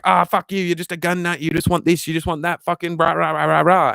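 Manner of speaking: high-pitched blustering partisan parody; mock angry left-winger. Streamer imitating left-wingers dismissing gun talk, mock angry rant. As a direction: dissolve a mock tirade into rah-rah noise. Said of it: "people on the left will be like".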